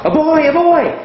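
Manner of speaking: high excited shouted yes; eager shout. Retold or imitated. Student shouting the answer to the teacher, high and excited. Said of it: "And you shout".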